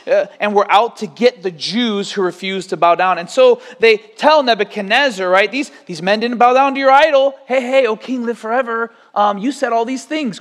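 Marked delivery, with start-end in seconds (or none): 5.5-10.4 higher, whiny tattling to the king; mock tattletale accusers. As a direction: tell on them like a snitch.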